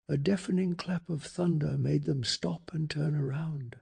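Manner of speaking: plain reading voice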